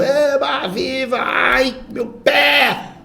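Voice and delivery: high mock cheers turning to an ouch; hammed-up high cries, stretched at the end; demonstrated exclamations. Shown as a demonstration. Teacher acts out exclamatory sentences, high mock-emotional cries. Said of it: "aquelas que expressam uma emoção".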